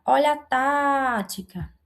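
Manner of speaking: "tática" with a slow, drawn-out "tá"; stressed first syllable. Shown as a demonstration. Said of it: "tem a antepenúltima sílaba mais forte".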